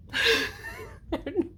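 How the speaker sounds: high burst of laughter; laughing. Real, not acted. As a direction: laugh at your own silly accent. Laughs at own accent.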